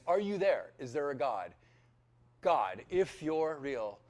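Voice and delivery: loud, slow pleading to God; desperate prayer. Retold or imitated. Broke man on his knees pleading loudly to God. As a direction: beg out loud, stretching the words.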